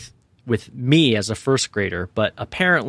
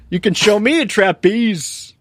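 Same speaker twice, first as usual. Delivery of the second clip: mock loud call; 10 semitones higher, 4 dB louder than usual. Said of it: "I said something really loud".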